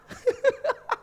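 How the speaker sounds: high burst of real laughter. Real, not acted. Speaker laughing at his brother story, high laugh.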